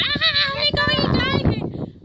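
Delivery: high, loud, slow panicked scream; hammed-up mum screaming on the beach; mock scream. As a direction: wail it like a frantic mum. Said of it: "like, screaming".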